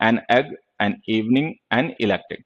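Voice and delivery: एन एग, एन इवनिंग said slowly, each word spaced; teacher reading examples aloud; slow demo reading. Shown as a demonstration. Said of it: "तो हमने एन लिख दिया".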